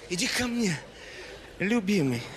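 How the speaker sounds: a hint of a sultry bride, low on любимый; lightly voiced bride calling her groom; bride's line. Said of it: "Реплика невесты". (низко и томно за невесту)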